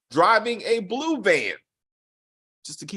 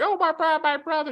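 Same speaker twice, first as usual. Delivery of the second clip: mock girl voice; 9 semitones higher, faster than usual. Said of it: "that little girl should be like".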